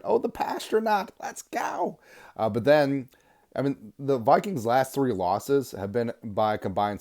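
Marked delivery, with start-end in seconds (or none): 0.0-2.0 much higher, squealing with glee; sudden giddy fan cheer; excited cheer. Real, not acted. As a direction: cheer him on in a high-pitched rush. Cheering a player's nickname.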